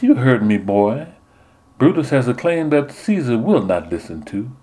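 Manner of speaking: high, fluttery belle voice growing faint; swooning southern belle. Retold or imitated. Nun as Portia swoons over Brutus, sweetly dramatic. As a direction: fan yourself and swoon as a belle. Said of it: "a sigh, touched her own face, fanned herself".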